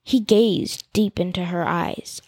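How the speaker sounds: usual speaking voice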